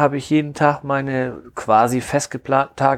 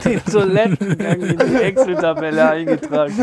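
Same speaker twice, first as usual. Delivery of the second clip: laughing incredulous echo, higher; laughing repeat; 6 semitones higher than usual. Real, not acted.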